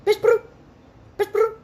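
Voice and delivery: high, loud mock attendant voice; imitating the flight attendant. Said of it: "ela falou assim".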